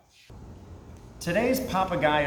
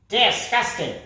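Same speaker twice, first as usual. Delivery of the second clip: high, disgusted, spat word; 7 semitones higher, 4 dB louder than usual. Acted. Parrot spitting out his disgust at the moon dog. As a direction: spit it out in disgust. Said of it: "spat Papagayo".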